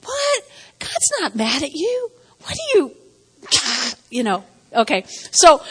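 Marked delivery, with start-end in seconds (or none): 0.0-3.0 incredulous. Retold or imitated. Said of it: "And I'm like".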